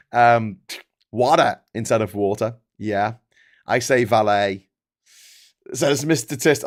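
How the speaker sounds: usual speaking voice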